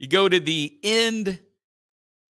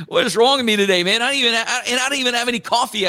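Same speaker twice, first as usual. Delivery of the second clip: ordinary talk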